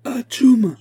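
loud, high-pitched explosive "atchoum"; big storybook sneeze; violent sneeze. A narrator's reading. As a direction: let out a huge acted sneeze. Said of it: "éternua juste au-dessous ... une telle violence".

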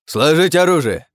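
loud, high, drawn-out command; shouted order. A narrator's reading. Thin pirate ordering the crew to lay down weapons, shouting. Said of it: "крикнул худой мужик".

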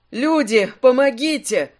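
half-hearted shout for help. A narrator's reading. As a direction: call out in restrained alarm. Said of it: "Слегка повысив голос, крикнул Леодан".

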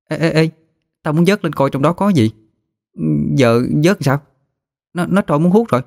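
put-on high-pitched, urgent child voice; eager girl. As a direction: nudge a friend before it slips away.

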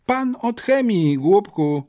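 high-pitched gleeful taunt; giggly mockery. A narrator's reading. Classmate mocking boy over the chemistry teacher, gleeful. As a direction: tease him in a high schoolgirl voice. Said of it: "zachichotała Nowicka".

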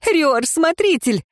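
высокий вызывающий возглас; exclaiming. A narrator's reading. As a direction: fling it at him, voice up high. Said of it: "воскликнула Ирейн".

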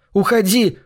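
high shout at the father. A narrator's reading. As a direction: yell it out high. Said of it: "выкрикнул он".

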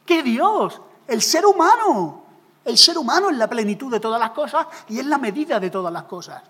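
a mild mock of a proud secular thinker, loud. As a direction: scoff at God, crowning man. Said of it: "una mente secular". (la mente secular burlándose de Dios, en voz alta)

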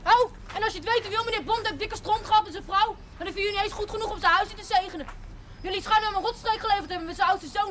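high-pitched, heated tirade; indignant outburst. Acted. Girl scolds the priest on her way out, high and heated. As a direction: let rip on the priest before storming off. Girl's angry parting outburst.

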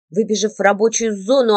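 ordinary delivery, nothing marked

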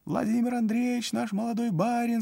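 high peasant voice calling out; voice from the crowd. A narrator's reading. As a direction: call out from the back. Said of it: "сказал голос из толпы".

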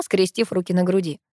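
plain reading voice

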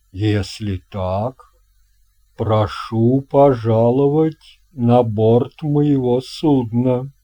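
put-on a hearty old captain welcoming a guest; captain's voice. Captain greets boy. (басовитый голос капитана)

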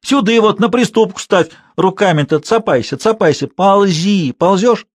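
high, bossy scolding; insistent high-pitched commands; old woman shouting. A narrator's reading. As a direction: shout orders while shoving from below. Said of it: "кричала".

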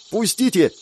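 high, urgent cry. A narrator's reading. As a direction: demand release with a contained yell. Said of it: "закричала Катарина".

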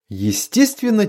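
plain reading voice

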